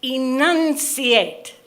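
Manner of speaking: over-articulate "enunciate", stretching out each syllable; mother's slow, exaggerated "enunciate"; enunciating. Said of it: "Don't mutter".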